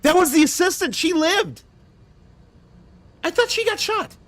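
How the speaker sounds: high, excited amazement; excited disbelief. Real, not acted. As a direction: gasp it in happy shock.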